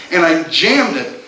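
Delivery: ordinary talk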